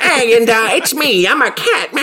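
high, loud hammy comic impression; Fred Travellini. Co-host summons an old comedian to play a cat, hammy high impression. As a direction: put on a squeaky funnyman bit. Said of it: "get, uh, America's Funny Man, Fred Travellini in here".

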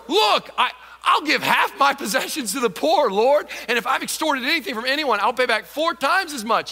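high, loud, eager rush; overjoyed Zacchaeus. Acted. Zacchaeus blurts his promises to the Lord, overjoyed. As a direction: blurt it out like a giddy convert. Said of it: "Zacchaeus stood there and said".

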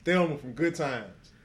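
loud, slow, drawn-out praise; slow swooning holler. Real, not acted. Friend naming a favourite actress, loud slow swooning praise. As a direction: savour every word, loud.

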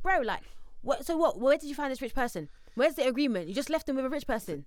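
an annoyed mock lecture; exasperated. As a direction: scold him in disbelief. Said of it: "you just think to yourself".

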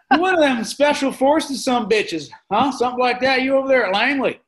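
high, loud, laughing mock roofer; loud roofer impression. Imitating a roofer quizzing a huge stranger, high and loud. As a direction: pester him like a loud construction guy. Acting out roofer questioning Adam.

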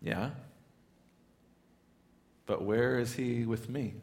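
subdued, hesitant aside after a pause; doubtful inner thought. Acted. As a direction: let the doubt out softly. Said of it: "And you're just thinking".

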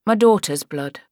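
plain reading voice

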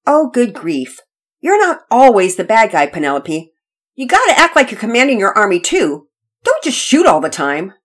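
put-on fed-up, bossy child correcting his sister; exasperated. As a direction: set a hopeless playmate straight. Brother scolds sister again.

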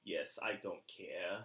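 low, slow, hushed Fudd reply; Elmer Fudd. Retold or imitated. Fudd answering about the Attorney General, hushed low. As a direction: murmur it like a fixated hunter. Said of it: "from Elmer Fudd".

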